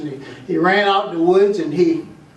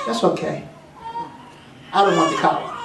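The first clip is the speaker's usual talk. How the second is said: low mangy dog; 5 semitones lower, 4 dB quieter than usual. Acted.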